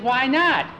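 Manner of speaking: put-on high, eager kid asking why not; eager boy voice. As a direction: pitch it up like an excited little boy. Boy eagerly proposing a plan.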